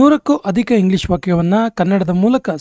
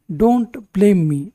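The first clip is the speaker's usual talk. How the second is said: slow; much slower than usual. Shown as a demonstration. Teacher models sentence for learners.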